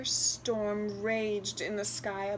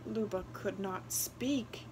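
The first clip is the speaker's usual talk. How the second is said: ordinary delivery, nothing marked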